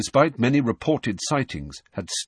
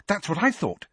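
eager, high-pitched agreement; Hermione; 8 semitones higher than usual. A narrator's reading. Girl agreeing about the readers' letters, eager. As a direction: jump in eagerly, voice lifted. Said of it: "said Hermione eagerly".

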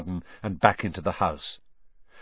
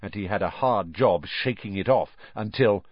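usual speaking voice

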